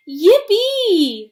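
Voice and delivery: loud joyful yell; gleeful shout. Acted. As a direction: whoop it out with glee. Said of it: "shouted Grandpa Joe".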